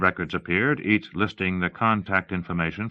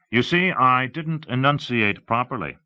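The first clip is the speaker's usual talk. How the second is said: higher-pitched explainer; lightly voiced demonstrator; 7 semitones higher, 4 dB louder than usual. Gam explaining the dialog box, lightly voiced presenter. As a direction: explain it with emphasis.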